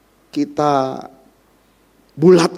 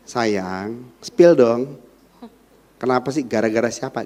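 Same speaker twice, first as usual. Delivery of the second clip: soft, affectionate coaxing; sweet coaxing; 7 semitones lower, 4 dB quieter than usual. Retold or imitated. Husband softly coaxing his sulking wife, tender. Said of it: "Mestinya gitu, istri kita cemberut".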